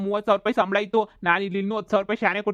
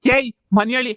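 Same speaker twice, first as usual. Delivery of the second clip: angry shout; 3 semitones higher, 6 dB louder than usual. Acted. Accusing the neighbour angrily.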